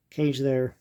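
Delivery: ordinary talk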